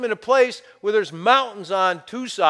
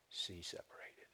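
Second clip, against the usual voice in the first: whispered, hushed with awe; awed whisper; 12 semitones lower, 27 dB quieter than usual. Acted. Preacher telling the sea parting, awed whisper. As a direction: breathe the words in wonder. Dramatic hush at miracle.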